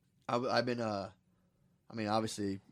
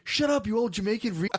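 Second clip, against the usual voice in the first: loud, high-pitched mock outburst; imitated yelling; 9 semitones higher, 5 dB louder than usual. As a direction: yell it like a furious kid. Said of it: "Joshua was yelling at the fu-, the Jamaicans".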